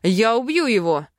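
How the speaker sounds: angry growled threat; growling. A narrator's reading. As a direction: snarl it like a furious elf. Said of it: "рыкнул эльф".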